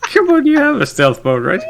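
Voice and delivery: high, laughing through the words. Real, not acted. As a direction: plead while cracking up. Laughing while pleading with friend.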